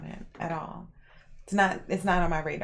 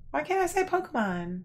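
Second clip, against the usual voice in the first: high, puzzled protest; why not, said with mock offense; indignant question; 8 semitones higher than usual. Real, not acted. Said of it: "Don't say Pokemon".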